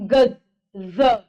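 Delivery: गज ending in a z, like "gaz", with ज said as a z, very slow; z sound demo. Shown as a demonstration. Teacher slowly sounds out the z in गज for students. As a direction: hold the buzzing z at the end of गज. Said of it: "जेड वाला".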